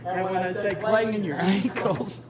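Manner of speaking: ordinary talk, nothing marked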